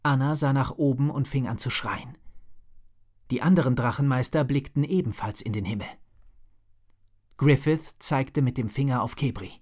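ordinary delivery

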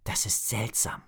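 a hushed inner thought, nearly breathed; thinking to himself. A narrator's reading. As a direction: think it quietly to yourself. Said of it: "dachte Drake".

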